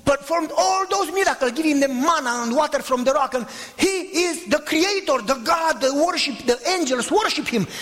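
high, ringing preacher's litany; fervent preaching. Real, not acted. Pastor preaching to the church, fervent and high. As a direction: build it like a sermon climax.

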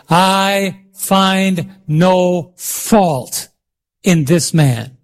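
very slow, word by word; spaced-out, deliberate scripture quote; slow group recitation. Real, not acted. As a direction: lead the congregation through each word. Leads congregation in saying verse together.

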